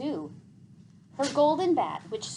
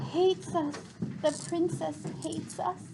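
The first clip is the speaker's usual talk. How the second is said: sad murmur; 9 semitones higher than usual. A narrator's reading. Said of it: "murmured Temp sadly".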